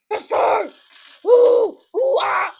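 high, loud hoots and shouts; monkey whooping. Pretend. Whooping monkey noises in play.

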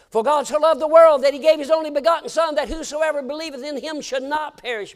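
high, loud scripture proclaimed; preaching scripture. Acted. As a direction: proclaim the verse to the hall. Quoting scripture loudly.

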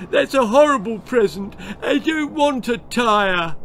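high, loud whiny complaint; whining baby. A narrator's reading. Baby complaining about the tyre, high whine. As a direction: whinge like a spoilt toddler. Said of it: "he whined".

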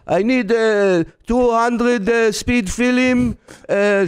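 high, halting mock Israeli accent; imitated Israeli accent, labouring over each word; struggling Israeli English. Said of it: "he starts with his English and his jaw's shaking".

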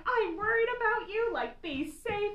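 high, pleading worried mother, slowing on be safe; worried mom imitation. Daughter voicing her worried mother, high and pleading. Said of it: "She's like".

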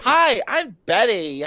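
normal talking voice

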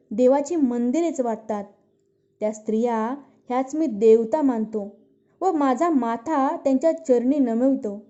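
plain reading voice

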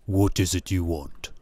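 low, subdued character voice for the owner; gallery owner. A narrator's reading. Gallery owner confronting an unwelcome visitor, low-voiced.

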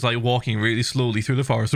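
normal talking voice

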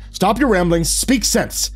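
loud, high, forceful command; impatient, furious demand; angry order. A narrator's reading. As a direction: cut through the babbling with a shout. Grabs flustered priest by collar, demands sense.

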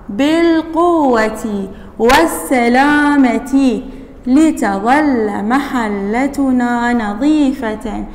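each word stretched out; slow dictation reading. Shown as a demonstration. Teacher dictating a sentence slowly to the class. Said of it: "الباء حرف جر".

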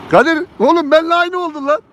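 high, excited teasing call; thrilled shout at a friend. Real, not acted. Laughing at friend's matching car.

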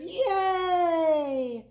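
high, loud, long-held yay; crowd cheer. A narrator's reading. Crowd celebrates Big Bark's capture, cheering. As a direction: let out a drawn-out hooray. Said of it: "the crowd cheered".

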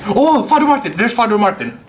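high, excited call-out; excited discovery. Real, not acted. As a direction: burst out on spotting a favourite. Streamer spots a known character.

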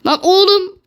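frustrated shout at the screen. Real, not acted. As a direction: yell it high in exasperation. Yelling at the player.

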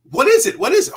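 high, loud repeated questioning; urgent begging to know. Retold or imitated. Actor begging the makeup artist, high and insistent. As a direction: pester them loudly, pitch way up.

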